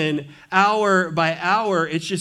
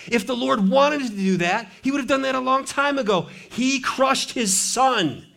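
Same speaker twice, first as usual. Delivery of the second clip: high, impassioned insistence; emphatic preaching; 8 semitones higher than usual. Real, not acted. Preacher's climax refuting self-punishment.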